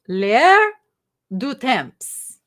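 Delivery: L'AIR loud and rising, then du temps after a pause; attempted French pronunciation. Shown as a demonstration. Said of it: "However you say that".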